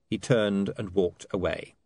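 plain reading voice

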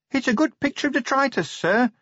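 high-pitched, nervous junior officer. A narrator's reading. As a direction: pipe it up anxiously to a superior. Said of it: "said Sergeant Littlebottom nervously".

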